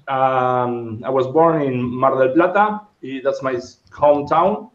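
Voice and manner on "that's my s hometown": normal talking voice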